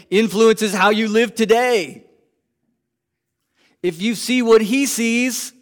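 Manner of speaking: loud, high preaching build; full-force preaching. Acted. Pastor preaching to his congregation, loud and high.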